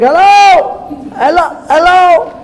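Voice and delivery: high, loud calling out; imitated screaming. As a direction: holler down to people far below, hammed-up. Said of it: "And the guy's like".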